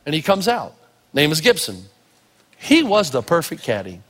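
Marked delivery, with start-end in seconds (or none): none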